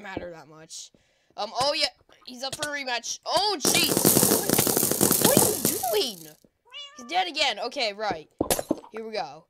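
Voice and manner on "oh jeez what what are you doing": high, loud startled yelp; startled outcry. Real, not acted. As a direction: cry out in alarm. Startled by attacker in game.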